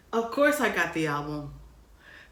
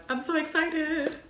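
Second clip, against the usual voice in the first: high excited squeal; 12 semitones higher than usual. Real, not acted. Vlogger gushing over her goddess shirt, squealing. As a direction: squeal with joy.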